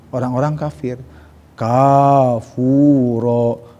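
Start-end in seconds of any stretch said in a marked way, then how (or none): 1.5-3.7 "Kafuro" with long drawn-out vowels; long vowel mad. Shown as a demonstration. Teacher showing correct long mad in kafuro. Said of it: "Itu minuman zat air kapu".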